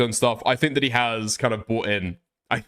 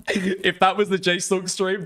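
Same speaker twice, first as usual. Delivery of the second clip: laughing; 5 semitones higher, slower than usual. Real, not acted. Laughing after his own bit.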